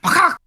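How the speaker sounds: high, loud imitated peck; sudden high squawk for a striking beak; beak strike noise. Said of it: "its beak came out and went".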